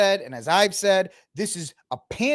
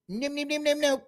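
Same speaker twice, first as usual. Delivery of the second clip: mock whiny no; 11 semitones higher, 5 dB quieter than usual. Mocking whiny refusal.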